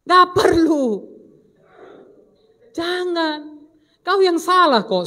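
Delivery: loud, high-pitched telling-off; acted stern dismissal of self-pity; scolding rebuke. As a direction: cut off the whiner with a forceful reproach. Preacher rebukes the imagined crying complainer.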